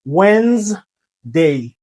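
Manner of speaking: Wednesday as slow "wenz... day"; correct pronunciation. Shown as a demonstration. Teacher modelling for learners, slow two-syllable Wednesday. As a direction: split Wednesday in two with a pause, silent D. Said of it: "il vient faire Wednesday".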